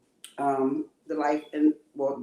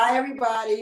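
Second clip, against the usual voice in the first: raised voice for a bad connection; louder, to be heard; 6 semitones higher, 6 dB louder than usual. Real, not acted. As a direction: project it so the host can hear. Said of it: "Speak up a little louder".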